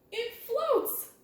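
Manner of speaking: high happy it floats; bright high announcement; delighted reveal. Acted. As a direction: sing out the result.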